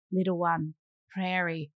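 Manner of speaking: low, hushed, halting words; dawning realisation. Retold or imitated. Mother remembering the baby in the car, hushed realisation. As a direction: let it sink in, softly and low.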